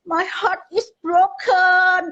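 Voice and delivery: put-on high, drawn-out child's wail; mock-tearful, high and slow lament; retold tearful wail. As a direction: imitate a heartbroken child crying out. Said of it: "oh, sambil nangis gitu".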